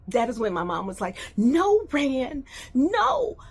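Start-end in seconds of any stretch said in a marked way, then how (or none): 1.4-3.4 pleading mom. Retold or imitated. Mom voiced pleading on phone.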